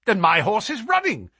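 high-pitched agitated cry; great agitation. Acted. Colonel realises his horse is running, agitated. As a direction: cry out, worked up. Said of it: "cried the Colonel in great agitation".

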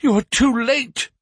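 high and loud, shocked; alarmed cry. A narrator's reading. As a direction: blurt the bad news out in alarm. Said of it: "I cried".

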